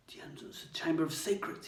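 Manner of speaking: hoarse, unvoiced whisper; boy whispering hoarsely in sudden realisation. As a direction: rasp it out, stunned. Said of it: "he said hoarsely".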